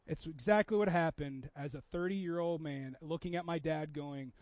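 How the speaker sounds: ordinary talk, nothing marked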